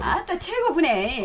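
drawn-out, high Jeolla praise; Jeolla dialect. Shown as a demonstration. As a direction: stretch it out with a Jeolla lilt. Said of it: "전라도 억양하고 경상도도 억양이죠". (전라도 억양으로 길게 늘여서)

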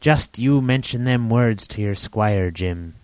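lightly voiced rambling old sailor; a hint of an eager half-mad hermit; old castaway Ben Gunn.

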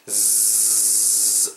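"즈" as a long, vibrating English z; drawn-out voiced z demo on "즈"; buzzing z. Shown as a demonstration. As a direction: hold "즈" as a sustained zzz. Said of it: "지 발음을 약간 이렇게".

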